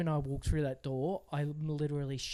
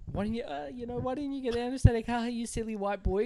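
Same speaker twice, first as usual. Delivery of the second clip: high, teasing mock Balinese accent; imitated Balinese accent; 9 semitones higher, 3 dB louder than usual. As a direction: imitate Balinese ladies mocking a customer, pitched up. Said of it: "They said that".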